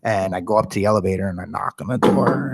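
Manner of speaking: ordinary delivery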